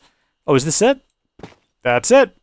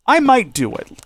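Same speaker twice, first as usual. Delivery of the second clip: higher teasing repeat; 9 semitones higher than usual. Acted. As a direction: lift it up high, teasing.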